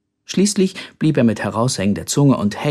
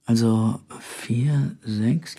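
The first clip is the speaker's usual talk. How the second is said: very slow, soft, pausing on each number; hesitant recall; 2 semitones lower, 5 dB quieter, much slower than usual. A narrator's reading. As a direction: think out each digit slowly. Brother slowly recalling the door code.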